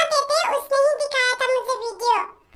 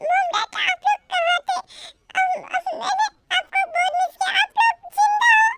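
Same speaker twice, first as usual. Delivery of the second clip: higher, crying with relief; tearful reunion; 5 semitones higher than usual. Acted. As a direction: sob out that her parents are alive. Daughter finds her parents alive.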